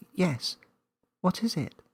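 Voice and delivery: put-on high little girl voice; Millie asking. A narrator's reading. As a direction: insist gently.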